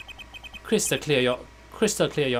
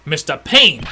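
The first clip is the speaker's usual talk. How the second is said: bellowing courtroom judge, much louder; stern judge shout; 9 dB louder than usual. Acted. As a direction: roar for order like a judge. Judge calls court to order.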